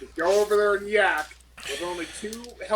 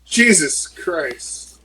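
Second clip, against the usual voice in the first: drawn-out louder disbelief; slow exasperated groan; 9 dB louder, much slower than usual. Real, not acted. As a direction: let it out slowly in disbelief. Exasperated at the game.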